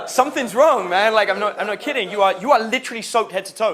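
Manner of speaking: high, loud retold protest; insist, as if the friend won't see the obvious; exasperated insistence. Coach confronts soaked student, exasperated insistence.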